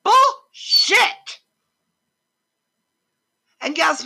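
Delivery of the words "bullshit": a sharp, high, loud cry of outrage; full-voiced furious exclamation; angry shout. Real, not acted. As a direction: spit the word out in disgust. Angry rant about veterans' treatment.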